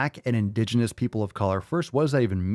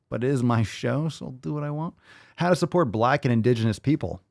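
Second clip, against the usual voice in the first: ordinary delivery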